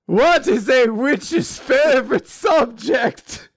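slow, high, showman-like setup; hammed-up riddle question; joke setup.

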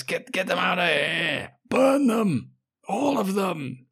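high, over-the-top dramatic yelling; ridiculously dramatic shout. Retold or imitated. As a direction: shout it like a melodrama villain. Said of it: "he shouts ridiculously dramatic".

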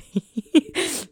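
a short laugh breaking out; amused laugh. Real, not acted. Friend laughing at the uncaring mates, short laugh. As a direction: laugh at how absurd it was.

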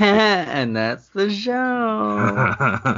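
stretched-out closing line; mock show sign-off. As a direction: draw it out like a host closing.

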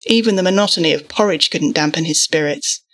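ordinary delivery, nothing marked

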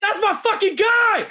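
high, slow, loud gleeful shout; Johnny's high, slow, loud gleeful shout at his friend arriving; excited shout. Retold or imitated. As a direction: yell it out, thrilled he made it. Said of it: "Johnny beside me".

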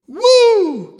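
high, loud startled "woo"; mock scream. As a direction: let out a playful scream of fright. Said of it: "I would probably scream too".

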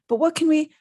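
urgent, cut-short question; gasped. A narrator's reading. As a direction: break off in alarm. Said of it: "Hermione gasped".

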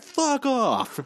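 high, hammed-up fed-up shove-off; mock brush-off. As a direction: snap it up high like a guy being swarmed. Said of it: "He's like".

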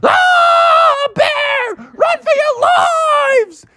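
very high, shrieking panic; panicked scream. Acted. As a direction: scream and flee from the bear. Townspeople flee the bear.

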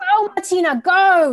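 high, loud rallying cry; urging shout. Real, not acted. Coach driving Martina through her anger, shouting. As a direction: egg them on as they scream.

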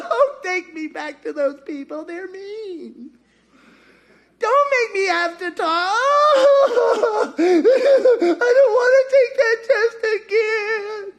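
high, drawn-out mock sobbing; wailing Elijah. Preacher playing Elijah begging off the test, mock crying. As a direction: whine and bawl like an exaggerated tantrum. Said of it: "if I'm Elijah".

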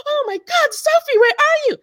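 very high, panicked mother; frantic mom. Retold or imitated. Retelling her mother's panicked cry on the phone. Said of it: "mom's reply".

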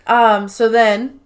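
ordinary talk

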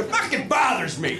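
hammed-up drunk rage; mock angry shout. Retelling his drunk self snapping at Paul, mock angry. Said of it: "I go".

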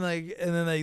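ordinary delivery

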